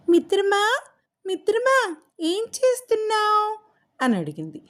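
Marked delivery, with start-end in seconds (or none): 0.1-0.8 high, bright little parrot calling out. Acted. Parrot voiced calling its friend. 1.3-3.6 high parrot voice asking a friendly question. Acted.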